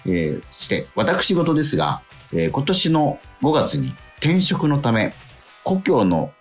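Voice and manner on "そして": usual speaking voice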